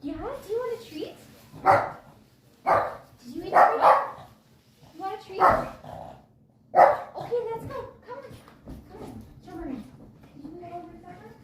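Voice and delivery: high cooing dog voice; talking to a dog. Real, not acted.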